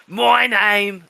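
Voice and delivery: Scottish turned Australian. Acted. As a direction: aim for Scottish, land on Australian. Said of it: "I just turned him Australian".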